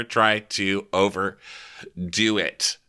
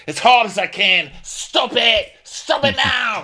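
very high, loud, desperate yelling; hammed-up panic over the anchor; frantic shouting; 5 dB louder than usual. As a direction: scream at them to stop, all-out. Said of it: "he's like".

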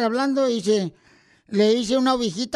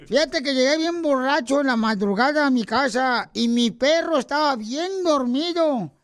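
ordinary talk

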